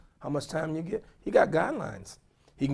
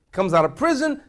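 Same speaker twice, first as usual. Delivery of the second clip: ordinary talk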